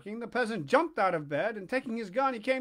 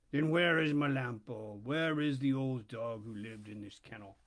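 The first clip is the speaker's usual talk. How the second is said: polecat questioning the puppet; low polecat voice; 7 semitones lower than usual. Acted.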